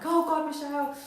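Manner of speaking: high, loud panicked cry; imitating a client in a panic. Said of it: "She was in a state of panic".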